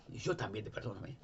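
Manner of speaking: Jesus whispers forgiveness to the weeping woman; tender whisper. Retold or imitated. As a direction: hush it to her like comfort.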